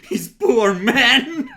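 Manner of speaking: high, loud laughing wail; laughing mock pity. Mocking sergeant, laughing.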